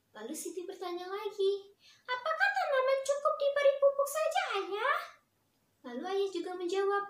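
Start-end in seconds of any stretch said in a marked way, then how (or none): 2.0-5.0 put-on high, loud child asking her father; small daughter asking her dad, high and bright; little girl voice. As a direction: pipe up like an eager little girl.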